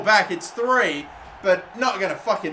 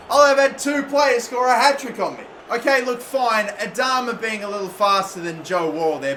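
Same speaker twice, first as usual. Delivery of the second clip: usual speaking voice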